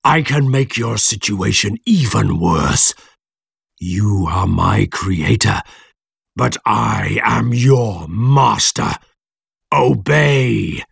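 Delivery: slow, commanding creature voice; menacing monster. A narrator's reading. Monster tells his creator who is master, slow menacing creature voice. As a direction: drag the words out with menace and command.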